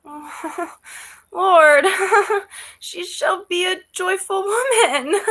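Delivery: laughing with delight. Acted. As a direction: giggle through the words. Nurse overjoyed at wedding news.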